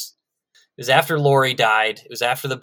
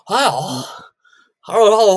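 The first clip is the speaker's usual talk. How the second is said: put-on old guy voice; 7 semitones higher, 3 dB louder, slower than usual. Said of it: "He's like".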